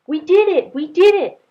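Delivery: high, loud, delighted shouts; gleeful cheer. Acted. Twins cheering their victory, loud and gleeful. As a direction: cheer like a kid who just won. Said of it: "laughed as they ran".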